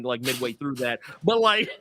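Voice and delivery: ordinary delivery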